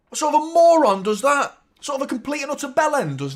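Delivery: self-mocking outburst. Real, not acted. Streamer berating himself, loud mock outrage. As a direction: yell it at your own stupidity.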